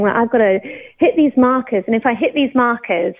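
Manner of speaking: ordinary talk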